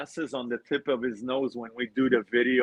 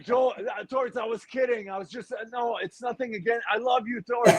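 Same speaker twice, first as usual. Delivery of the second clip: pleading apology; 9 semitones higher than usual. Retold or imitated. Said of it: "I was like".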